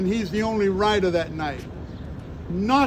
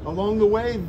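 ordinary talk, nothing marked

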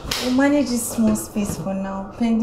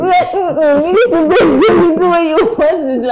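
teasing through giggles, higher and louder; giggling tease; 8 semitones higher, 9 dB louder than usual. Acted. Wife teasing husband to bathe first, giggling. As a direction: laugh as you push him off playfully.

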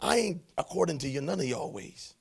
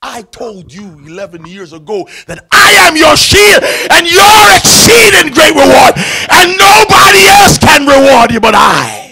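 much higher, louder, slow, crying out; shouted preaching; 7 semitones higher, 22 dB louder than usual. Acted. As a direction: preach it with a breaking cry at full force. Preaching God's promise at full force.

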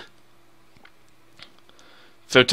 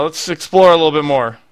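ordinary talk, nothing marked

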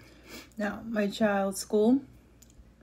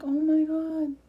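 higher, squealy delighted exclaim; gushing oh my God; 8 semitones higher, slower than usual. Retold or imitated. Reacting to kindergarten graduation videos, gushing high. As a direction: gush over the cute videos. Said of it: "I'm like".

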